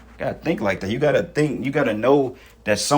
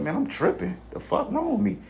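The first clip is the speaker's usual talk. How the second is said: exasperated self-talk; 11 semitones higher, 5 dB quieter than usual. Retold or imitated. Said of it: "I'm like".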